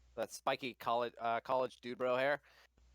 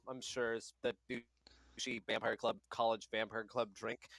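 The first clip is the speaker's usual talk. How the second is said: normal talking voice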